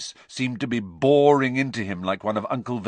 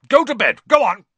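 high, loud, angry order; angry snarl; 13 semitones higher, 9 dB louder, faster than usual. A narrator's reading. Uncle sending his nephew away, snarling loudly. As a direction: order the boy out sharply. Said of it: "he snarled at Harry".

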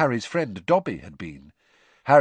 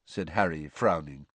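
usual speaking voice

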